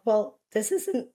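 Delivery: normal talking voice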